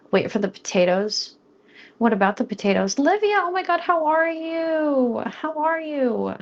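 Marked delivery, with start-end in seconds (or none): 3.0-6.3 high, long drawn-out hello; gushing greeting. Real, not acted. Streamer greets a returning viewer, gushing. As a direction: squeal hello, stretching the words in delight.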